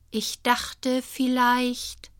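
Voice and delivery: zögernd, höher und sehr langsam; tastend, als traue sie sich kaum; vorsichtig. A narrator's reading. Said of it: "sagt sie vorsichtig". (vorsichtig und zögernd, etwas höher)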